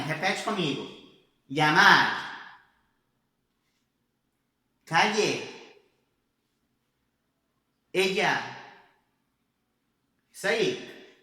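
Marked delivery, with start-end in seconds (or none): 1.5-8.4 Llamar, Calle, Ella said loud and slow to be repeated; Spain pronunciation. Shown as a demonstration. Teacher says words loud so the class repeats, Spain-style ll. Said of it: "repetir primeiro como se fosse na Espanha".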